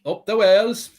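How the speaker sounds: put-on rising, chirpy Welsh accent; cheery Welsh fan accent. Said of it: "Your Welsh accent and [name] impression".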